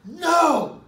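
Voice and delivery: loud, high, flat refusal; defiant no. Retold or imitated. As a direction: slam the door on the order. Angry refusal to the guard.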